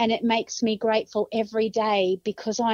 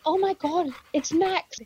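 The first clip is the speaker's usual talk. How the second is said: surprised; 6 semitones higher than usual. Retold or imitated. Retold astonishment at seeing the dog.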